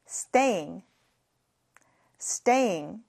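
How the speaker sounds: "Staying" said clearly, ending in ng; model pronunciation. Shown as a demonstration. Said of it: "같이 발음해 볼까요". ("Staying" 또박또박 발음)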